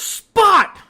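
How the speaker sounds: very high, very loud shout; mock yell. Mother-in-law leaning over to the dog, yelling. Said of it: "The mother leans over again and goes".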